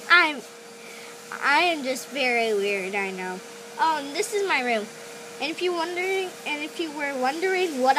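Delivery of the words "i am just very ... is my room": normal talking voice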